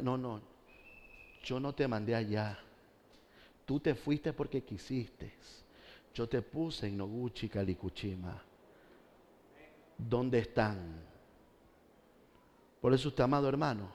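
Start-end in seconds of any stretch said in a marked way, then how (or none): 0.0-11.0 very soft, low, measured reproach; quiet divine rebuke. Acted. The Lord answers the pastor who left, quiet stern rebuke. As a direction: correct them quietly, letting the words slow down.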